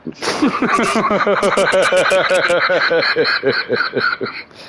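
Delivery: long, high cackle falling away; helpless laughter. Real, not acted. Watching something wild on screen, long helpless high laughter. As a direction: burst into a sustained high laugh until breath runs out.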